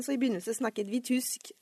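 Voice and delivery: ordinary talk, nothing marked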